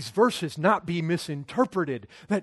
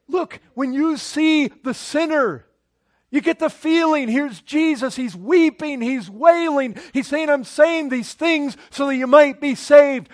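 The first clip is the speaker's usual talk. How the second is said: loud, higher pleading sermon voice; impassioned preaching; 6 semitones higher, 10 dB louder than usual. Acted. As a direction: plead with the congregation at full force. Preacher describes Jesus weeping over sinners.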